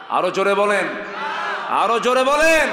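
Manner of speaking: building from loud call to full high shout; preacher shouting for a response; rallying shout. Real, not acted. Urging the congregation to answer louder.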